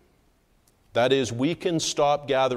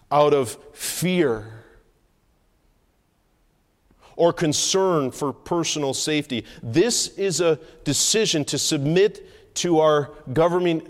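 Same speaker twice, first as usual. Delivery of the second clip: raised, louder pulpit voice with firm pauses; forceful preaching; 5 semitones higher, 6 dB louder than usual. Real, not acted. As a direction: drive each phrase home to the congregation.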